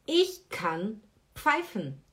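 Ich kann pfeifen, said slowly and carefully; slow teaching read. Shown as a demonstration. Teacher models the German sentence for learners, slow and clear. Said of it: "Assobiar seria pfeifen".

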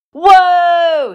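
loud high chorus of whoa; awed whoa. Acted. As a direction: gasp it out in wonder together. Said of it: "said the farm girls all together".

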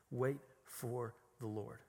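"Wait for the Lord" drawn out, word by word; slow, deliberate. Acted. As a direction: space out "Wait for the Lord", slowly and softly. Repeats verse slowly for emphasis.

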